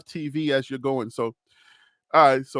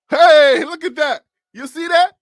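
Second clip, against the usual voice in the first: loud, high "hey" of delight; excited; 9 semitones higher, 9 dB louder than usual. Real, not acted. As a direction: show it off, pitched way up. Delighted by his on-screen graphic.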